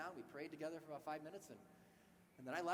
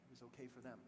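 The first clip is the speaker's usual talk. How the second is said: subdued and hurt; 7 semitones lower, 5 dB quieter than usual. Retold or imitated. Man recalls friend lost on 9/11.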